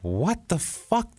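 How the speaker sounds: high, drawn-out disbelief; incredulous. Retold or imitated. Podcaster reacting to impossibly cheap New York rent, incredulous.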